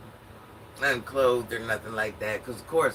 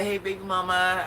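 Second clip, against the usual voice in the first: higher, louder smooth greeting; imitating slick ex; 6 semitones higher, 4 dB louder than usual. Ex greeting her as he walks out, lightly mock slick. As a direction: lightly play the ex trying to charm her. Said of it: "he's like".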